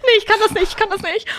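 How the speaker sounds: high, frantic protest; acted panic. Friend too scared to cope with the clown, high panic. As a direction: squeak out your refusal in fright.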